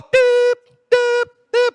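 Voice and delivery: high-pitched spoken bleeps; squeaked "pi" covering curses; censor bleep. Acted. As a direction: imitate a TV swear-word bleep. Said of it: "Pedro então começou a falar".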